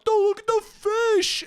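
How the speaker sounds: very high, slow, stretched squawk; mock a drunk guy in a squealing voice; drunk dude. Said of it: "they fucking are like".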